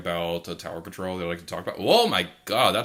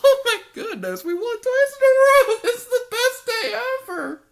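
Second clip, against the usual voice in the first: high, laughing, tearful shrieks of joy; shrieking jackpot winner; 8 dB louder than usual. Real, not acted. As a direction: sob and squeal with delight. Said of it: "I gotta admit, I'm tearing up".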